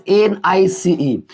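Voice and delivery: very slow एन आई सी ई, one letter at a time; spelled out slowly. Shown as a demonstration. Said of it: "जैसे हम लोग बोलते हैं nice". (धीरे धीरे spelling)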